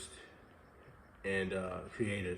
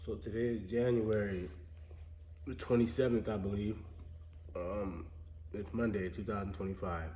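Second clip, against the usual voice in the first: stuffy nasal voice from a sinus cold; nasally voice. Real, not acted. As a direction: talk through a blocked nose. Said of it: "nasally s-, voice".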